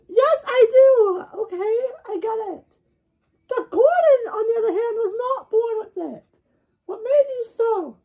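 squeaky high character voice; high cartoon voice. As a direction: pitch way up, cartoonish.

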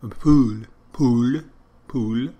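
mock French accent mangling "pool"; Inspector Clouseau. An Englishman affecting French, trying to say "pool", mock French accent. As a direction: repeat "pool" as a put-on French mispronunciation. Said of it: "When he's trying to say pool".

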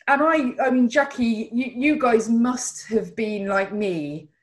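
ordinary delivery

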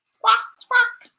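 high, loud squawking jay; bird squawk. Acted.

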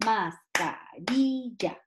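"mascarilla" drawn out as mas, ca, ri, lla; syllable by syllable. Retold or imitated. Teacher imitating children marking the tonic syllable, syllable by syllable. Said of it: "Los niños dicen".

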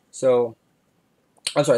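usual speaking voice

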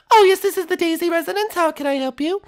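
high, polite falsetto princess. Acted. Princess answering the residence phone, high and polite.

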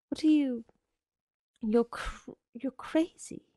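high, halting, mildly alarmed voice; frightened stammer. A narrator's reading. Connie backing away from the door, frightened stammer.

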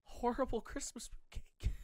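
whispered aside in disbelief. Real, not acted. Gamer to viewers, reading a weird item, whispered. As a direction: breathe it out as a hushed whisper.

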